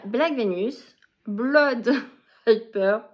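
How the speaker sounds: English titles read with a strong French accent, breaking into a laugh. Real, not acted. Vlogger reading English book titles aloud, in a clumsy French accent. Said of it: "Désolée pour mon accent d'anglais qui est pitoyable".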